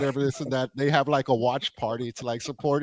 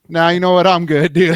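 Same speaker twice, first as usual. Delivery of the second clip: raised, much louder quoted voice; mock loud refusal; 5 semitones higher, 11 dB louder than usual. Coworker turning down the cage fighter, mock loud refusal. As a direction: blurt it out loud and high, playacting.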